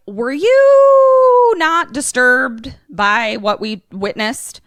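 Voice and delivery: high, slow, loud prissy voice; mock-appalled high drawl; mocking Danny. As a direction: stretch it out, shocked and prim. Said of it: "Danny is like".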